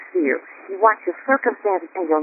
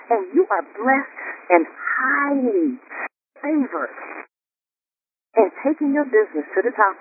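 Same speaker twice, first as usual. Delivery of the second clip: higher, building litany of blessings; preaching list; 5 semitones higher than usual. Acted. Host preaching the affirmations to the callers, building. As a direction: preach each word like a promise.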